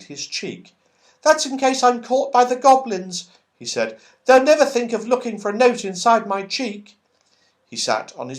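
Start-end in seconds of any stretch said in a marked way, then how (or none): 1.2-7.0 lightly voiced high little squirrel; a small eager squirrel voice, higher; red squirrel offering to carry the note. A narrator's reading. Squirrel character speaking.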